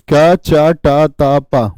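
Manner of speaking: each syllable drawn out slowly; slow, deliberate teacher drill. Shown as a demonstration. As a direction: spell out the sounds slowly for the class. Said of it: "क्या बोलते हैं".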